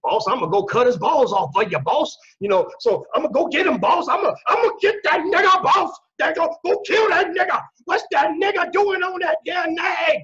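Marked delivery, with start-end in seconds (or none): 0.0-2.1 mock eager servant. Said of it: "They get all excited". 3.1-10.2 higher and louder, building to a wild yell; frenzied eager servant. Retold or imitated. Servant eager to please the master, yelling wildly. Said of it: "They get all excited".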